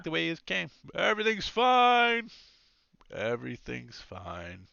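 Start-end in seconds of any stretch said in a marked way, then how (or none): 0.9-2.3 high, loud all-clear call. Acted. Guard heads back reporting all clear, calling out. 3.1-4.7 slow, softer, lower repeat; fading call. Acted. Guard heading back the way he came, fading call.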